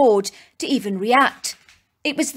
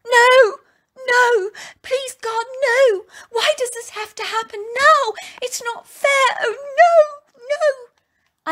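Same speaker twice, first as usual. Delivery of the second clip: screaming and crying; slower than usual. Acted. Stranded woman screams at her car stuck in mud, crying. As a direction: wail it out, begging God. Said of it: "I began to scream and scream at the top of my lungs".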